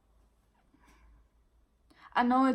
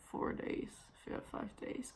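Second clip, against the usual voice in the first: whispered aside; 13 dB quieter than usual. Real, not acted. Embarrassed admission.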